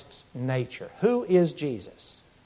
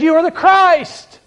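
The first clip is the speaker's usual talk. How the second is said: loud, high, slow proclamation; bold declaration; 11 semitones higher, 8 dB louder, slower than usual. Retold or imitated. Peter speaking what was in his heart, bold declaration. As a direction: declare it boldly at full voice, drawing it out. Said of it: "a bold leader and a bold spokesman".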